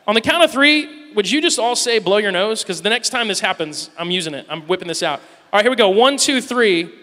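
rally the church to shout together; calling out. Real, not acted. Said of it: "leading congregation in a chant".

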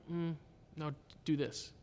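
very soft, hushed nudge; quiet inner voice. Acted. Conscience steers him on a decision, quiet gentle voice. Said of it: "it's like this quiet voice".

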